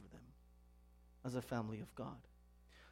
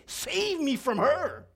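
high, slow mock plea; hammed-up desperate appeal; frustrated husband; 10 semitones higher, 7 dB louder, much slower than usual. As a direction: beg a stranger for rescue from your wife. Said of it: "he was getting so frustrated".

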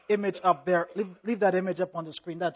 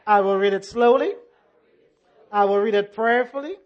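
church affirmation. Acted. Congregation Bible affirmation.

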